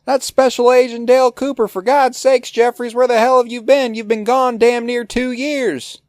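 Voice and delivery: very high, loud shouting voice; Gordon Cole shouting. Retold or imitated. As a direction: bellow every line like a deaf boss. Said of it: "Cole going".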